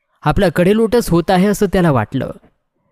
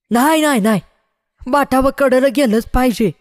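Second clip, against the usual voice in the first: high-pitched protesting villagers; crowd's outcry; 10 semitones higher than usual. A narrator's reading. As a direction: cry out insistently, pitched well up. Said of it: "लोकांनी ओरड केली".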